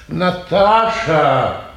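long drawn-out call. Acted. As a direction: stretch the name as you call. Calling for Наташа again. (протяжно зовёт)

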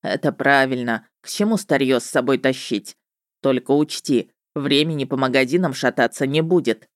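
low witcher's voice breaking in; ведьмак. A narrator's reading. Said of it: "голос ведьмака".

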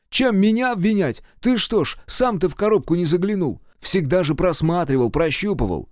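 high, loud defensive reproach; indignant accusation. A narrator's reading. Quarrel over who is to blame. (возмущённо обвинять в ответ)

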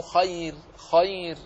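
خير with an open fatha on the kha and a still ya; open khayr. Shown as a demonstration. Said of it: "فتح الخاء وإسكان الياء".

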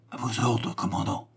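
hushed reply to the commander; à voix très basse. A narrator's reading. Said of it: "à voix très basse". (à voix très basse, presque murmuré)

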